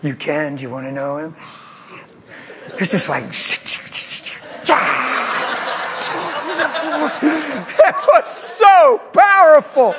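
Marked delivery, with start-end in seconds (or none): 3.4-8.0 high delighted laugh; burst of laughter. Real, not acted. Preacher laughing at the memory. 8.1-9.9 slow, high and loud; preaching shout. Real, not acted.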